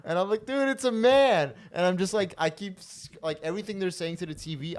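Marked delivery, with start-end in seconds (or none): 0.4-1.5 high, loud, drawn-out exclamation; hilarious disbelief. Retold or imitated. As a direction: yell it to your buddy in delight. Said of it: "I'm crying laughing".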